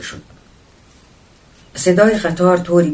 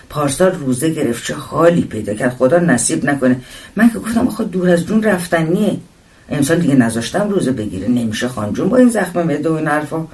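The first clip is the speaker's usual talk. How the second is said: fast, animated chatterbox voice; garrulous woman passenger; 4 dB louder than usual. Acted. Woman complaining about ulcers to a stranger, fast and garrulous. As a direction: rattle on like a talkative fellow passenger.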